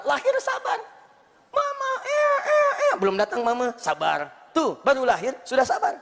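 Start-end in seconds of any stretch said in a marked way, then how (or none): 0.0-0.8 squeaky repeat. Shown as a demonstration. 1.5-2.9 high squealing baby voice, mock crying for mama; newborn baby cry. Preacher mimicking a newborn baby calling for mama, high whiny cry.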